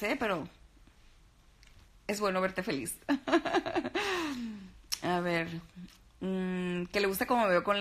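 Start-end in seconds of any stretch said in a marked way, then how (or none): 3.0-4.7 a bright high burst of laughter. Real, not acted. Vlogger laughing at a viewer's amusement, high laugh. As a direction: laugh along at Héctor, pitched up.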